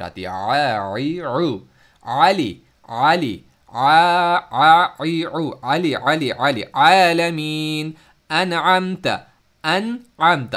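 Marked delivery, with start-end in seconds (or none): 0.3-7.9 आ ई उ आली आलमीन loud, rolling from the middle throat; throaty ain drills; circling ain sounds. Shown as a demonstration. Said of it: "आवाज़ घूमती हुई और सर्कुलेट करती हुई". 8.3-10.5 अनअमत with a clear hamza stop then a rolling ain; tajweed-style अनअमत; throaty recitation. Shown as a demonstration. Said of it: "अब देखें".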